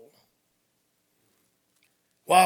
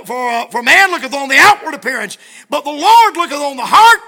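loud, rising preacher's cry; preaching at full force; 4 semitones higher, 13 dB louder than usual. Acted. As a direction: proclaim the verse to the church. Preaching the scripture.

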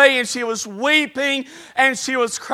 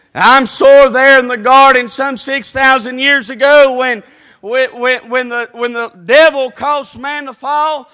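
preaching build-up; 8 dB louder than usual. Acted.

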